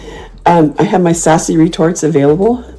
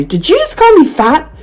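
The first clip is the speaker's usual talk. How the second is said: high-pitched, loud public call-out; very loud retort; 12 semitones higher than usual. Retold or imitated. Turning on a rude man at the post office, very loudly. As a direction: re-enact a loud, accusing question. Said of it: "I said very loudly".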